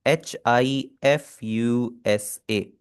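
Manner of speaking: letters spelled one by one very slowly; teacher spelling a name in romaji. Shown as a demonstration. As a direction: dictate each letter slowly. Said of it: "Hifsa हो जाता है इस तरह".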